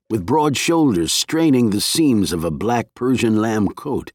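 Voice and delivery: plain reading voice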